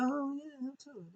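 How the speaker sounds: high, very soft voice; offhand mock reply. Wrestler brushing off a challenger question, offhand and hushed.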